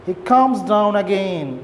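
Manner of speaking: ordinary delivery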